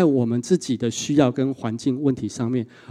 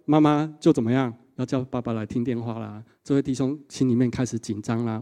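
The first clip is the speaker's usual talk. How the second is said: normal talking voice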